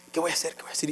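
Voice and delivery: murmullo muy bajo, para sí mismo; desesperación contenida imitada. Retold or imitated. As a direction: imita hablar solo, apurado y en voz baja. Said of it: "me empiezo a desesperar". (apuro murmurado, actuado)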